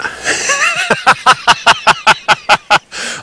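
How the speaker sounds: helpless high-pitched giggle; high squealing laugh. Real, not acted. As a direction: break into a squeaky laughing fit. Laughing at his own joke.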